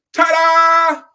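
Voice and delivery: high, very loud showman ta-da; ringmaster ta-da. Retold or imitated. Preacher acting out the acrobats for the congregation, big showy ta-da. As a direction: belt it out like a circus performer. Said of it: "throw their arms up and go".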